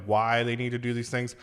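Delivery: usual speaking voice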